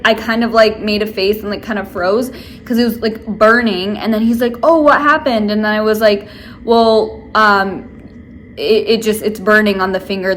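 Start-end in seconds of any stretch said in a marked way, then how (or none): 4.6-5.5 higher, louder mock concern; lightly voiced surprised nail tech; quoting the nail tech. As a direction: play the nail tech asking innocently.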